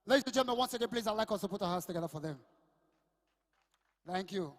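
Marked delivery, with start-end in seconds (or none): 0.0-2.4 громко, быстро, как конферансье; объявляет аплодисменты; MC announcement. Real, not acted. MC announcing applause.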